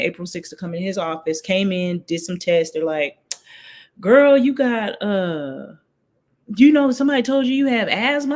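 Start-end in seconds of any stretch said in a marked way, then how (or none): 4.0-8.4 sassy nurse. Retold or imitated. Nurse quoted, loud and high.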